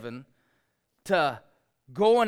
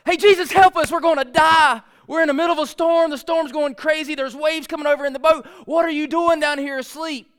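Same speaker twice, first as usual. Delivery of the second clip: much higher and louder, frantic plea; panicked cry for help; 11 semitones higher, 12 dB louder than usual. Retold or imitated. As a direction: yell it like the boat is sinking. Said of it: "I believe it was more like".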